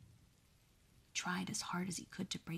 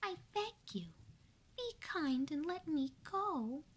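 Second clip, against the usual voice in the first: pleading mouse; 9 semitones higher than usual. Acted. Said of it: "pleaded the little mouse".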